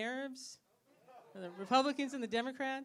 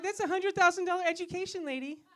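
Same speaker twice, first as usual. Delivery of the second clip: exasperated outburst; 6 semitones higher, 8 dB louder than usual. Retold or imitated. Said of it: "I'm like".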